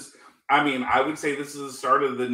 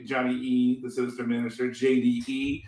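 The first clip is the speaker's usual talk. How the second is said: normal talking voice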